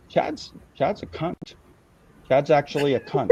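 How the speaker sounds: low, understated imitation of a blunt verdict; lightly imitated low deadpan quote; Gonzo. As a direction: deliver the insult low, with pauses. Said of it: "I want Gonzo to come on and say".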